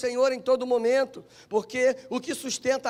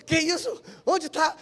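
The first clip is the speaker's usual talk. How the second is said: retold alarm, pitched up; alarmed questioning; 5 semitones higher than usual. Preacher replaying his reaction to news of an accident, alarmed. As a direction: fire back worried, higher questions.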